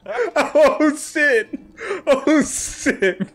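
high, laughing oh shit. Real, not acted. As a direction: laugh it out, caught off guard. Laughing at being overheard.

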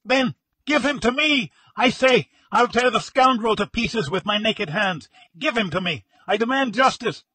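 high, loud raging demand; frantic widower shrieking for justice; hysterical shrieking. A narrator's reading. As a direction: scream for vengeance, nearly out of your senses. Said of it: "he shrieked".

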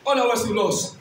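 usual speaking voice

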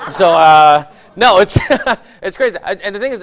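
ordinary talk, nothing marked

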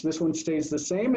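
ordinary talk, nothing marked

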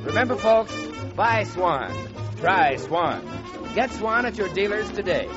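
ordinary delivery

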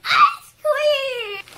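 loud, high excited shout. Real, not acted. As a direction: yell it with delight. Excited shout after anesthesia.

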